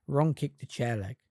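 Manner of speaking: usual speaking voice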